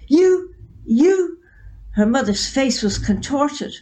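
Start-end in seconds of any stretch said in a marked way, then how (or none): 0.1-1.4 high, loud, choked-off sputter; sputtering fury. A narrator's reading. Mother sputtering with rage at her daughter. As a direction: spit out the words in fury. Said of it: "she started screaming".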